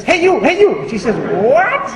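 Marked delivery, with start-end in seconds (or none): 0.0-0.9 high squawky parrot call. Acted. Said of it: "the parrot says". 1.4-1.9 high, rising indignant question. Acted. Woman snaps back at the parrot.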